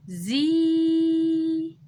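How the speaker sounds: زي sung out long, high and loud; long vowel. Shown as a demonstration. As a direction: hold the ee of زي for two beats. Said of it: "صباعي مقفول".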